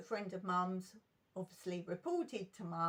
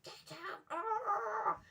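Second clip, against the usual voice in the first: imitated angry yelling; 11 semitones higher, much slower than usual. Angry teacher yelling at a small child, mock fury. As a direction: squeeze out a stifled mock scream, rising high. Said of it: "she was yelling and her face was red".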